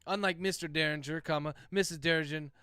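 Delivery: usual speaking voice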